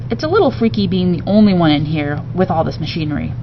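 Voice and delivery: plain reading voice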